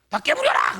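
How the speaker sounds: high, loud mock yell; scolding Jeolla mother. Jeolla mother at a child who broke something, mock shout. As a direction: burst out like an imitated furious mother. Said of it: "전라도 엄마가 이렇게 책망하는".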